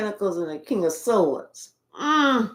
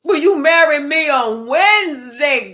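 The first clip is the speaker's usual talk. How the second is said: loud, higher-pitched mock proposal; imitated urgent proposal; 4 semitones higher, 7 dB louder than usual. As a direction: blurt out the proposal at full voice. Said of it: "They're ready to be like".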